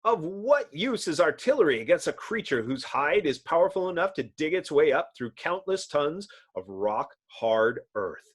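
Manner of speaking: plain reading voice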